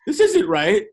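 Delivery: retold alarm. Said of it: "I was like".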